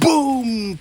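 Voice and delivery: high loud boom; startling boo. Acted. Second scare intro shout.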